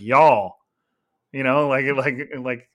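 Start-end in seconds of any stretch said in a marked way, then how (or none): 0.0-0.6 "Y'all" stretched long and punched loud with pride; mock Southern "Y'all" drawn way out. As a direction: drag out "Y'all" slow and proud, like a Southerner. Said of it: "like, draw it out".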